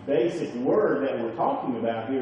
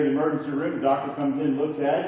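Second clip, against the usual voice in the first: usual speaking voice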